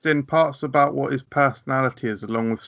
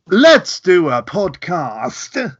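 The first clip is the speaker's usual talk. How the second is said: very slow, louder and stretched; drawn-out announce; 4 semitones higher, 6 dB louder, much slower than usual. Pretend. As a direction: drag it out big. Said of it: "do you wanna give it a go?".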